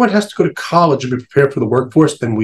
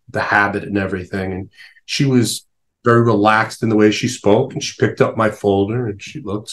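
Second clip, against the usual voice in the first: usual speaking voice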